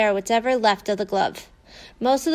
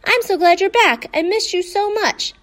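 high, eager puppy greeting; higher, breathless joy at the reunion; overjoyed; 8 semitones higher, 4 dB louder than usual. A narrator's reading. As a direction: gush over her like a happy dog. Dog greets returning girl.

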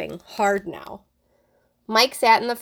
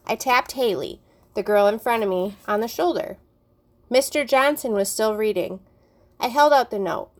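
plain reading voice

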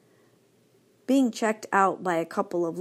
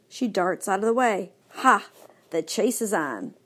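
ordinary delivery, nothing marked

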